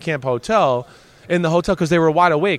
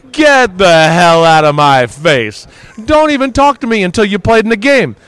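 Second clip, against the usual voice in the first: imitating Sapp's loud dismissal; angry brush-off; 4 semitones higher, 7 dB louder than usual. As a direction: shove him away with your voice. Sapp rebuffs rookie handshake.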